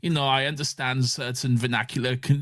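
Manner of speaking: apologetic German speaking English; precise, over-correct English with a German accent. Retold or imitated. German apologising for supposedly bad English, imitated German accent. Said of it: "a German speaking English".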